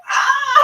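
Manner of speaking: high, loud delighted squeal; excited squeal. Retold or imitated. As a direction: squeal at being asked out. Retold reaction to date offer.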